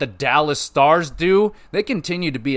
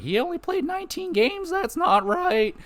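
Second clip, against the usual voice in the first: whiny-nerd impression, pitched way up; nerdy cartoon kid. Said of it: "doing that voice a lot today".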